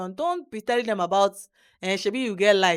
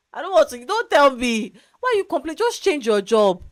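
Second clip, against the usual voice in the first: higher and louder, fed up with the whining; exasperated outburst; 6 semitones higher, 9 dB louder than usual. Retold or imitated. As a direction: snap back at the complainers, loud and high. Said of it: "And I'm like".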